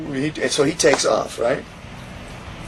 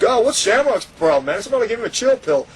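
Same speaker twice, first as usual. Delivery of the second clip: mocking rival; 8 semitones higher than usual. Mocking rival wrestler at the gate.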